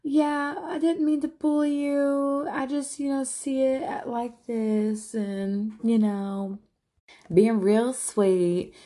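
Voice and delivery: high, sugary mock-innocent voice; mocking Avery's fake sweet apology to Jesse, high. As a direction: imitate her putting on fake sweetness, slow at the end. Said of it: "being fake back to him".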